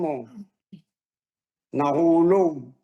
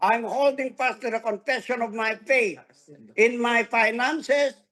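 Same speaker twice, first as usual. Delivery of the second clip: leading a declaration; 5 semitones higher, 3 dB louder than usual. Shown as a demonstration. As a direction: proclaim it with building conviction. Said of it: "Say it with your mouth". (masiglang pagpapahayag ng pananampalataya)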